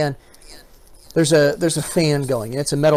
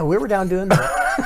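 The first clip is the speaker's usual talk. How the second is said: laughing; 5 semitones higher, 3 dB louder, slower than usual. Real, not acted. Recalling playing with son.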